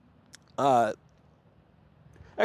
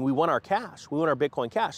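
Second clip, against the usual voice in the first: ordinary delivery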